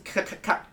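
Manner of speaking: quick "ka-ka-ka" clicking noise; unvoiced clicks in a row; imitated lock clicks. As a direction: mimic a dial clicking round.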